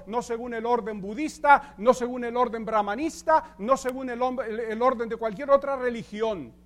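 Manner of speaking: preaching. Acted. Pastor preaching to the congregation about priesthood, emphatic and loud. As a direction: drive each point home from the pulpit. Preaching doctrine with rising force.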